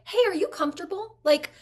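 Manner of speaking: imitating Emmy Rossum. Guest retelling the producer welcoming her, lightly voiced reassurance. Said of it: "pulling aside a guest star to be like".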